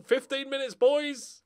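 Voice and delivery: normal talking voice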